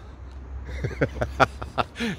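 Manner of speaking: breathy unvoiced chuckle; amused airy laugh at the saying; quiet laugh. Real, not acted. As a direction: laugh softly under the breath. Laughs after nan's saying.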